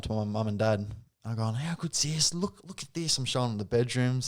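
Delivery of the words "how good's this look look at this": higher-pitched, softly re-enacted excitement; excited. Showing mum and dad the new unit on FaceTime, excited. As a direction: show off the place in a higher voice. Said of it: "we were both just so excited".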